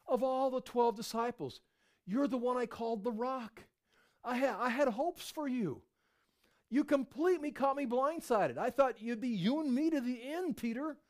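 high, hammed-up hurt lament; mock wounded Jesus. Pretend Jesus guilt-tripping Peter, hammed-up hurt. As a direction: overplay a betrayed friend, pitched high. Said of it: "He didn't go".

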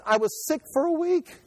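high strained voice as the weak prophet; Daniel, bedridden and worn, voiced high; Daniel shaken. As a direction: sound wiped out by the angel's visit. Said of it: "And then Dan says".

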